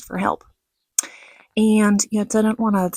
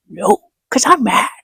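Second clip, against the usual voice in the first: whispered aside; 4 semitones higher than usual. Real, not acted.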